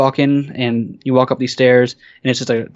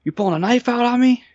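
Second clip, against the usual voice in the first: high-pitched incredulous cop question; challenging police officer; 11 semitones higher than usual. Retold or imitated. Policeman confronting the kid over a fallen knife, mock challenge. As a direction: mimic an officer challenging a suspect.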